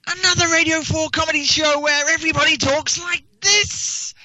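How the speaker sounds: high, loud mocking sitcom voice; Radio Four comedian parody. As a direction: overplay a posh radio comic. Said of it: "his sketch was basically going like this".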